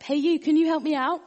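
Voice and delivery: high, loud shout for help; "Hey, you" called high and loud; calling up from a hole. Retold or imitated. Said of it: "and the guy shouts up".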